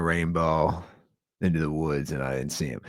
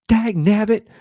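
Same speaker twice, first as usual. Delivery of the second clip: high, loud exasperated cry; 8 dB louder than usual. Retold or imitated. Imitating a flustered neighbor chasing his dog, high exasperated cry. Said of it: "And he's like".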